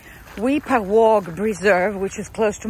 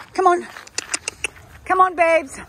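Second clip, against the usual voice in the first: high, louder coaxing call; calling dogs; 9 semitones higher, 3 dB louder than usual. Real, not acted. Walker urging her dogs on, high calling voice. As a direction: call the dogs along, pitched up.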